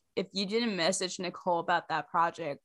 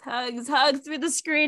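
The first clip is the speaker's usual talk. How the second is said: slow, higher and louder, reaching out warmly; hugs through the screen; 5 semitones higher, 3 dB louder, slower than usual. Real, not acted. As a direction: stretch out the hugs to comfort her. Sending virtual hugs to friend.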